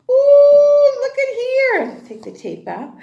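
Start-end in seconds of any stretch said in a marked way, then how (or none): 0.0-1.8 excited gasp. Acted. Reveal of hidden picture.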